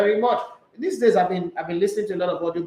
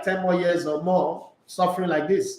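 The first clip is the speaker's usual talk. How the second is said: normal talking voice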